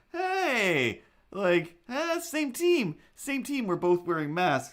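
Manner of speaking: high, loud "same team" delight; gleeful inner cheer. Retold or imitated. Greeting a masked stranger in her head, gleeful. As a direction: cheer at a fellow mask wearer. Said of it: "in my head was like".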